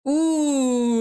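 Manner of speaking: long, high "ooh", gliding down; drawn-out ooh. Real, not acted. Watcher reacts to the penalty check, drawn-out ooh. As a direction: let out a long, sustained ooh.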